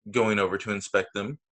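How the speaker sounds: ordinary delivery, nothing marked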